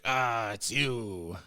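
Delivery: slow, drawn-out English pirate voice. Acted. Villager greets the newcomer, English pirate accent. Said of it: "It's like an English pirate sound".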